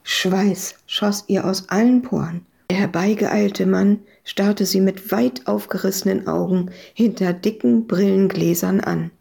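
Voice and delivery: ordinary delivery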